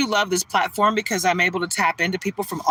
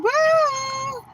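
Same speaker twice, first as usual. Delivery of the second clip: excited cheer. Real, not acted. Cheering for her hometown.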